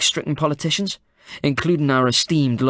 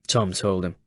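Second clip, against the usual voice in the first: ordinary delivery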